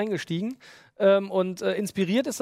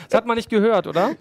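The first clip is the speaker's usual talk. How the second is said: ordinary talk, nothing marked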